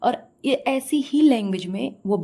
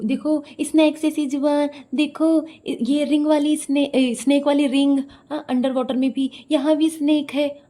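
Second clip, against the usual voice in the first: mock excitement; 6 semitones higher, 4 dB louder than usual. Mimics her pointing out snakes.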